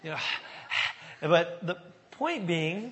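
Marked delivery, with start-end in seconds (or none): 0.2-1.2 breathy imitation of a winded dog; mimicked dog panting. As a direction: pant like a worn-out old dog.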